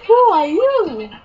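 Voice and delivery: high, loud swooping question; SpongeBob. Retold or imitated. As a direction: ask in exaggerated wonder. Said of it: "SpongeBob says".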